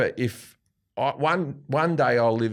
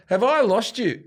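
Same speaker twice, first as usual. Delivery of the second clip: high, raised call out to the crowd; 10 semitones higher, 4 dB louder than usual. Retold or imitated. Retelling the show, calling to the crowd loud and high. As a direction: throw the question at the crowd, louder. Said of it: "I go".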